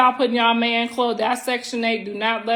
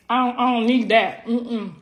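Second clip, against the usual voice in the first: usual speaking voice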